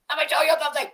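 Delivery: mock high cartoonish voice; put-on voice. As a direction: squeak it out in a silly voice. Same line said twice.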